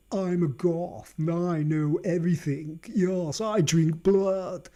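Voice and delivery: slow, snooty imitation of a goth girl; mocking a self-important goth. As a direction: lightly sneer it out as her.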